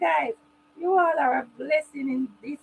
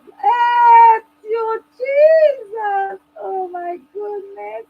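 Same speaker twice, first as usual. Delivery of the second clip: high, loud tearful thanks; tearful praise; 8 semitones higher, 9 dB louder, much slower than usual. Real, not acted. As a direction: cry out in grateful praise. Overwhelmed praise after singing.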